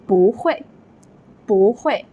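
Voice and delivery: 不会 with 不 rising in second tone; bú huì, rising then falling. Shown as a demonstration. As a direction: lift 不 before the falling 会. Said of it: "hear the same change in these examples".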